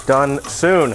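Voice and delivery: ordinary delivery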